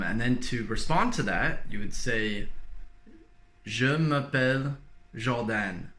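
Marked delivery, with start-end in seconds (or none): 3.6-5.9 Jordan said the French way, zhor-DAN; French-accented name. Shown as a demonstration. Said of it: "I changed my own name from Jordan to Jordan".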